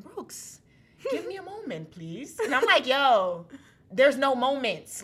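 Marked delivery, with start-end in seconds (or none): 0.0-2.4 high, soft imitation London accent. Ex asking her for a moment, London accent. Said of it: "in his London accent". 2.9-5.0 loud, slow, mock-aggressive refusal; aggressive impatience. Pressing her ex for more, loud and impatient. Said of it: "I was, like, so aggressive".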